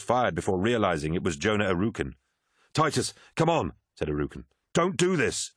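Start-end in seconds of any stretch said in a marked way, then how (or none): 2.7-3.7 urgent, raised pitch; acted pleading. Crewmate pleading with Titus after gunfight, urgent. 4.7-5.5 high, urgent appeal; acted pleading. Crewmate begging Titus not to shoot, urgent.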